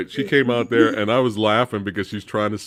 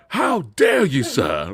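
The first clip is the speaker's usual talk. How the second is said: over-the-top British; slower than usual. Retold or imitated. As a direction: pile on an exaggerated British accent. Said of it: "Like, super overly British".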